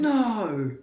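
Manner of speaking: high startled no then a flustered correction; sudden shout. Real, not acted. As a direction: blurt out in surprise. Shocked interjection, then correction.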